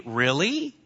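doubtful rising really; questioning really. Shown as a demonstration. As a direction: say it like you doubt it. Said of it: "With a question mark?".

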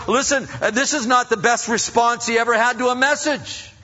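high, loud, driving sermon voice; fiery preaching. Acted. Pastor preaching to the congregation, loud and high. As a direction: preach it full force to the crowd.

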